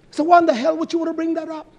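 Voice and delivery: high, loud and fast rebuke; indignant outburst. Real, not acted. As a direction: fire the question at her in outrage. Indignant rhetorical question.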